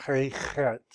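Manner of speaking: "He" with plain H, "Chet" with throaty Ch; H, hard H. Shown as a demonstration. Teacher naming two letters, hard H on "Chet". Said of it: "H and then the hard H".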